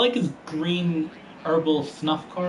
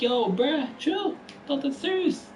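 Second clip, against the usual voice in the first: very high-pitched pleading to back off; mock protest; 9 semitones higher than usual. Driver telling Hemrick to chill, high mock protest. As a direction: play it squeaky and taken aback.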